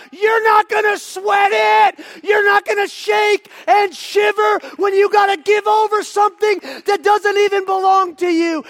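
high, loud, drawn-out proclamation; full-force preaching. Real, not acted. Preacher urging the congregation about the tithe, shouting high. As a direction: shout each point home to the congregation.

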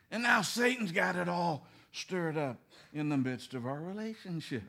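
usual speaking voice

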